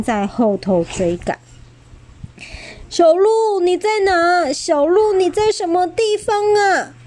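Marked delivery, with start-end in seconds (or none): 2.9-6.9 高声带哭腔地呼喊; 急切地大声喊着找人. Acted. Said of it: "霸王龙哭喊着". (带哭腔的高声呼喊)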